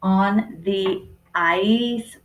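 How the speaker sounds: very slow, each word spaced; teacher dictating to young pupils; slow sounding out. Shown as a demonstration. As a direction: write it as you say it, slowly. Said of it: "again, I'm spacing between each word".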